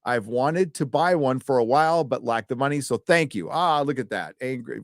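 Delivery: ordinary talk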